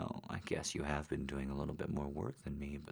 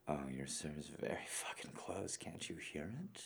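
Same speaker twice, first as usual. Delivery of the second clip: breathless explicit whisper; 9 dB quieter than usual. Acted. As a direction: whisper it close to the mic. Whispering throughout.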